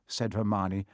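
ordinary delivery

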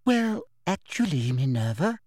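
high, sugary correction; cloying, girlish false sweetness; simpering. A narrator's reading. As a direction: simper as you contradict a colleague. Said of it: "simpered Umbridge".

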